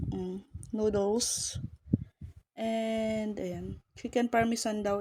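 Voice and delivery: normal talking voice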